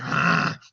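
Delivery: loud wordless growl; Beast's snarl. Acted. Beast getting in Cogsworth's face, menacing snarl. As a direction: snarl right into someone's face. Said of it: "gets right in his face".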